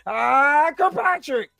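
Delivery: a hint of a high, loud Tony Baker impression; lightly voiced comic bit, high-pitched; mock Kirkpatrick call. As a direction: squeal the name up high as a joke. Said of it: "Gave him the Kirkpatrick".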